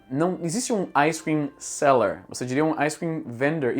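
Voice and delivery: ordinary delivery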